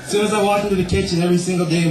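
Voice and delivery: usual speaking voice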